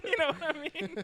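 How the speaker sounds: laughing question. Real, not acted. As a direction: giggle through it. Still laughing at joke.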